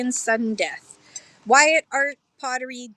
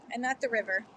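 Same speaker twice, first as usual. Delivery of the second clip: ordinary talk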